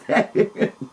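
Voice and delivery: breathy unvoiced laugh. Real, not acted. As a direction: laughs at the memory. Laugh heard alone.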